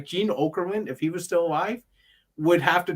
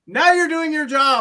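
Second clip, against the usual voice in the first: much higher, louder sing-song praise; mock approval; 12 semitones higher, 7 dB louder than usual. Fan imitating the man praising Asuka, high mock cheer. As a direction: pat him on the head with your voice. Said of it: "He's like".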